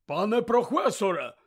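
loud high-pitched exclamation; loud exclaim from a big man. Acted. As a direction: call out alarmed, breathless. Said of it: "вигукнув він".

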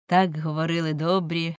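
ordinary delivery

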